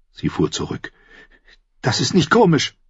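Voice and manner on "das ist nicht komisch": high, offended woman's voice; indignant Cersei. Acted. Sister recoiling from the proposal, high indignant voice. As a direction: rebuke him in a high voice.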